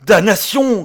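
high, loud frantic curse; frenzied shout. A narrator's reading. Frantic man cursing at the sailor, frenzied shout. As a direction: explode in frenzy. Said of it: "cria mon ami avec frénésie".

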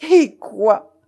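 sudden loud startled cry. Acted. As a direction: blurt it out loudly. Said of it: "s'écria-t-elle".

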